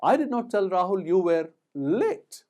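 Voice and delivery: loud run-up, then "late" set apart high; stress on LATE. Shown as a demonstration. Teacher showing emphasis changes meaning, stress on "late". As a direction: hold back briefly, then land on "late". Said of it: "depending on where we lay emphasis".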